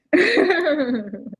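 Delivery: bright laugh. Real, not acted. Homeowner laughing with relief after buying.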